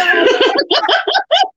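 high loud laugh. Real, not acted. Friend laughs out loud over the amazing cakes.

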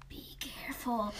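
soft eerie whisper; spooky warning whisper; creepy whisper. Retold or imitated. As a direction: hush it like a haunted voice. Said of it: "the creepy voice being like".

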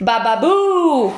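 slow, loud stumbling ba-ba-boo; stammered boo. Acted. Gilbert fails at his boo, stumbling ba-ba-boo. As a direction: fumble the boo like a clumsy little ghost. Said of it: "φώναξε ο Γκίλμπερτ".

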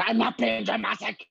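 high, indignant mock outburst; dramatic protest. Actor insisting to best friends they're not overreacting, hammed-up protest. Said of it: "you're maybe being a bit".

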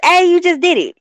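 excited callout. Retold or imitated. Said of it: "leave a clip like".

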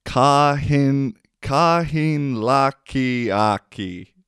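slow Savo Finnish "kahin laki aki", stretched vowels; Savo accent. Retold or imitated. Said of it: "has a funny accent".

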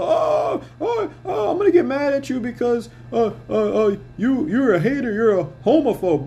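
high-pitched mock whining; mocking complaint. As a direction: wail it mockingly, dragging out each stammered "oh". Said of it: "you can't pull the".

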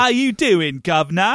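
high, hammed-up British greeting; put-on British accent. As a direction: do a cartoonishly fake British accent. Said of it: "it felt so put on".

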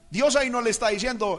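usual speaking voice

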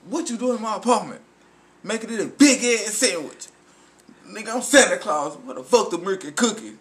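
high, loud mock Santa voice; quoting Santa. Friend reciting a movie line as Santa, hammed-up. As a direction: play the Santa line big and fast. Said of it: "He says".

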